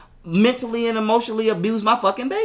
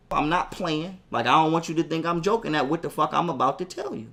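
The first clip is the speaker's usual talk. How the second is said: normal talking voice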